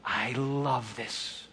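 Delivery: very slow, low, hushed tenderness; God's tender response. Acted. God answering the praying heart, tender and hushed. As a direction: let each phrase rest softly, intimately. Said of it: "responds in the same way".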